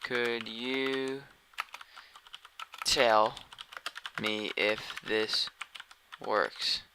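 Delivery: drawn-out words with gaps between them; slow, word-by-word. Real, not acted. Testing a live stream by typed message, slow word-by-word. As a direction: say each word slowly, as if typing it.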